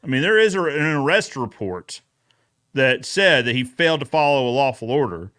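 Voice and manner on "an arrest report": usual speaking voice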